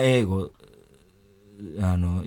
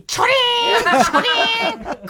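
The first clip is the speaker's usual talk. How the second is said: very high, drawn-out coin jingle; imitated coin clink; slower than usual. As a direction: squeal out a long falsetto clink. Imitates coins dropping on each listen. (裏声で長く伸ばす小銭の音まね)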